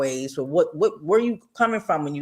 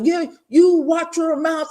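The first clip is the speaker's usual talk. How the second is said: mock gruff warning; 13 semitones higher, 4 dB louder, slower than usual.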